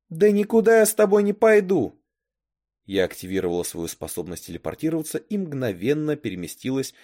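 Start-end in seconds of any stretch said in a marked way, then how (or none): 0.1-2.0 high, loud defiant outburst; defiant refusal. A narrator's reading. Girl defies the boy threatening prison, loud refusal. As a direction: refuse loudly, pitch held high.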